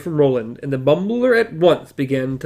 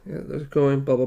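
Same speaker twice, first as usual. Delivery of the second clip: ordinary delivery, nothing marked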